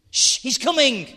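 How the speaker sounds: high, loud hushed alarm; urgent whisper. Acted. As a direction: warn the gang he's coming. Kids hiding from old man.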